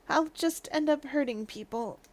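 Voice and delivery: trailing away very softly; barely audible. Acted. Suspect admits his weakness to investigators, barely audible. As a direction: let the words sink almost out of hearing. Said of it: "I couldn't hear what he just said".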